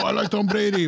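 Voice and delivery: a lighter hint of his dad's Mexican accent; tossed-off Mexican accent imitation. Son quoting his dad in a Mexican accent. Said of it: "He says it in a heavy Mexican accent".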